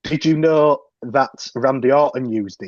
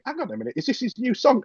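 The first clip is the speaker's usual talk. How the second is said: surprised; 11 semitones higher, 4 dB quieter, faster than usual. Retold or imitated. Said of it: "hearing it and going".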